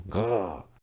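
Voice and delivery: low growl of regret; frustrated growl. Acted. Frustrated regret over his lost boxed copy.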